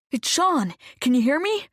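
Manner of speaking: loud, urgent calling to wake her. Acted. Sean calls loudly to his unconscious friend, urgent. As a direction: call loudly, trying to get through.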